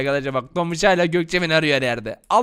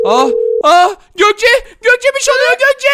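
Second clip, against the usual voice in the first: panicked cry; 10 dB louder than usual. Acted. Phone rings, he transforms.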